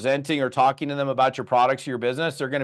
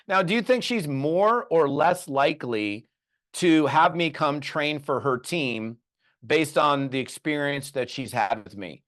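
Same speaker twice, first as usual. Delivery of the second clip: normal talking voice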